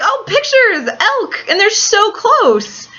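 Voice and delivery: high-pitched, drawn-out excited squeal of words; excited gushing. Retold or imitated. Friends spotting a herd of elk up close, excited gushing.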